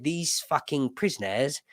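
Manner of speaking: mock English accent; lightly hammed-up English voice. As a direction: put on a British accent for the quote. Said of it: "the English people said".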